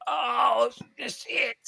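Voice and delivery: high-pitched wail of dismay; dismayed dying dwarf. Acted. Game master plays shrinking dwarf, dismayed wail.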